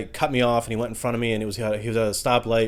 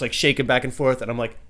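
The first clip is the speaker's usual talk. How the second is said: normal talking voice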